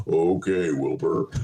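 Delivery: low, drawn-out talking-horse reply; a light take on the TV horse; Mister Ed voice. Pretend. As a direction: play the talking horse answering. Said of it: "his health advisor will be Mister Ed".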